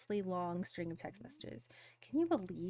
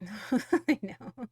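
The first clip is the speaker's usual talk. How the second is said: louder, laughing through the words; 2 semitones higher, 9 dB louder, much slower than usual. Real, not acted. Streamer answering chat, laughing loudly. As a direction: laugh as you say it.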